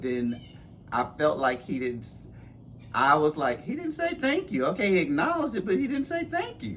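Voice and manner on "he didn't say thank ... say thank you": high-pitched, put-out replay of a grievance; indignant complaint. Retold or imitated. Godmother replaying her reaction to getting no thanks, indignant. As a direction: pitch it way up, affronted.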